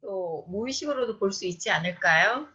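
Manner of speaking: usual speaking voice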